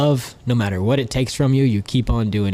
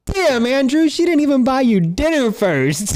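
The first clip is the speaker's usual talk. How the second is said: high, teasing voice of the guard; hammed-up sassy guard quip; mock guard teasing; 13 semitones higher, 4 dB louder, slower than usual. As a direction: tease him like a sassy guard.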